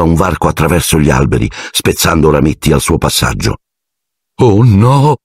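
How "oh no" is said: frightened exclamation. A narrator's reading. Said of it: "esclamò Ron".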